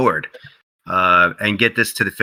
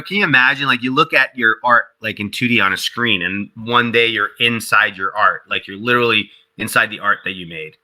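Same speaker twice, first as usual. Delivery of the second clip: ordinary talk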